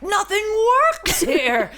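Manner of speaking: high, loud, wailing mock complaint; frustrated outburst. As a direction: re-enact an exasperated cry. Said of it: "I just got so frustrated".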